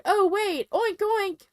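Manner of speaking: high, playful oinking; silly imitation of a pig; mock pig oinks. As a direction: squeal out the oinks like a piggy. Imitating pig noises in a retold joke.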